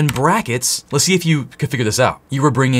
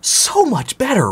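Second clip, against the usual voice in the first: excited gush; 10 semitones higher, slower than usual. Real, not acted. Hyping up lists to viewers.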